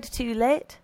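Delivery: plain reading voice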